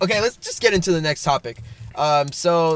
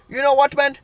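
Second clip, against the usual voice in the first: mock-offended Indian-accented man; indignant retort in a mimicked Indian accent; imitated Indian accent; 7 semitones higher, faster than usual. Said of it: "he has an accent".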